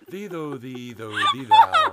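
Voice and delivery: rising hammed-up squeal; mock poetic wail. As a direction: climb into a shrieking falsetto. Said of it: "I'm Edgar Allan Poe".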